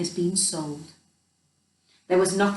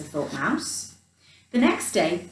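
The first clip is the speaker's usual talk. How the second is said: ordinary delivery, nothing marked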